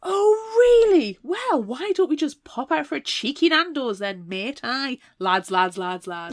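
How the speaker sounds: high, loud mock lad voice; mock laddish British. Mocking the quote's laddish British voice.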